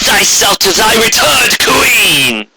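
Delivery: high, slow, imperious royal decree; regal demon queen. Acted. Returned queen demanding the village present itself, imperious. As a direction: command the cowering ponies like a returned tyrant. Corona's commanding voice.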